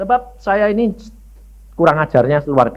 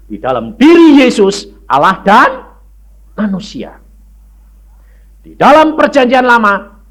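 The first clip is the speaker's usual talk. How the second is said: fiery preaching; 6 semitones higher, 6 dB louder than usual. Acted. Preacher proclaiming God to congregation, loud and high. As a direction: hammer each truth home to the congregation.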